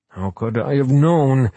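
spluttering. Acted. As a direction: splutter it out indignantly. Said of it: "came the spluttering response".

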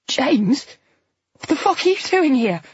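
high, shocked, breaking; near tears. A narrator's reading. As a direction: cry out in horror. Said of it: "She was almost crying".

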